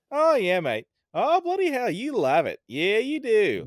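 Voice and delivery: high, loud Aussie peaks and valleys; Australian accent. Shown as a demonstration. Guest shows co-host how Australians talk, exaggerated Australian accent. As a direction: swoop up high and come down on each Australian phrase. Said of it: "that's a lot of the accent".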